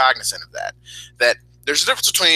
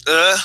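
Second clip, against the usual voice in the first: higher, louder frustrated ugh; louder groan; 4 semitones higher, 5 dB louder than usual. Retold or imitated. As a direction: let the groan out with more force. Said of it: "I kind of".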